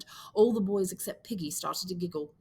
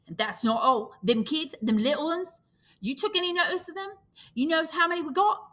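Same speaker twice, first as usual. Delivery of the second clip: put-on higher and higher, anxious boy; Piggy, rising alarm; 11 semitones higher, 4 dB louder than usual. Piggy demanding about the little ones, building higher. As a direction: climb in pitch with worry.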